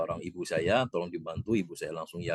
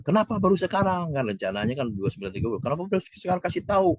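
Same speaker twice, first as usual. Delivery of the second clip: raised, urgent imitated questioning; alarmed questions; 8 semitones higher, faster than usual. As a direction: mimic her worried demand, pitched up. Bunda reacts on the phone. (menirukan nada cemas yang meninggi)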